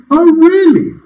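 high-pitched sceptical retort; teasing, incredulous comeback; mock disbelief. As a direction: swoop up high as if unconvinced. Mocks the boast just voiced.

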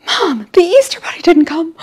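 put-on high, far younger kid's voice, disappointed; upset little kid. Young kids telling mom the bunny didn't come, upset. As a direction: play the small child who found no basket. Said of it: "they were like".

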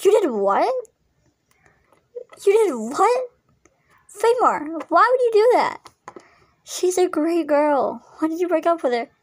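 loud, high-pitched disbelief; shocked outburst. Acted. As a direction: react in shock at a friend's breakup. Friend shocked by news of breakup.

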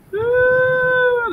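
a high, long admiring ooh. Real, not acted. Streamer admires his parked truck with a high ooh. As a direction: coo at the truck like it's gorgeous.

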